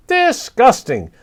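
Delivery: high, slow squawk of disgust; parrot voice. Acted. Papagayo scolding the moon dog, high slow parrot squawk. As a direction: drag out the word like an indignant bird.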